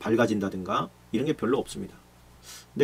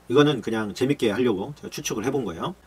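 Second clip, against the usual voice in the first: usual speaking voice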